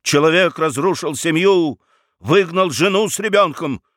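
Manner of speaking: high, declaimed accusation; indignant. A narrator's reading. Lawyer denouncing the baron to the burgomaster, indignant. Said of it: "возмутился Рамкомф".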